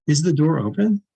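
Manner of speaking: "Is the door open?" rising at the end; question tune. Shown as a demonstration. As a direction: lift the pitch on "open", asking a question. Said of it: "Question's not true or false".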